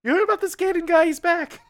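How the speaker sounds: high, fast Jay Leno impression; chirpy, sped-up Jay Leno voice. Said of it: "whenever I do my Jay impression".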